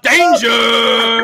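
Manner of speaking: loud, high announcer call; big hype-man shout of the guest's name. Acted.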